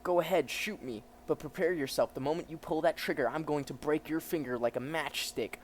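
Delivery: lightly voiced bold threat; a hint of cocky menace; defiant hero. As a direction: dare him to try it.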